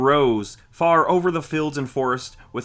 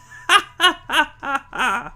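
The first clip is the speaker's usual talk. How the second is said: high evil cackle; shrill gloating hag laugh; witch cackle; 14 semitones higher, 5 dB louder than usual. Acted. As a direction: cackle with glee at the trap. Witch laughs after her rhyme.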